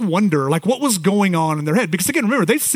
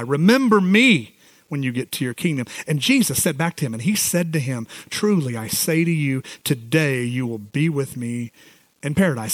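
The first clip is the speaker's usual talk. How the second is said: hushed solemn scripture reading; 7 semitones lower than usual. Acted. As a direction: read the passage gently and lower. Reads and retells the passage.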